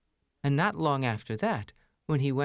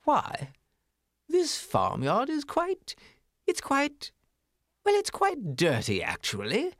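much higher, fussy little mouse voice; a prim, high city mouse picking at words; snooty city mouse. Acted. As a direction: turn your nose up at the farmyard. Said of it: "said the city mouse".

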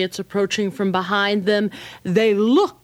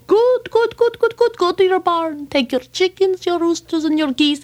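excited rabbi; 11 semitones higher than usual. Acted. Rabbi delighted with his plan, high and eager.

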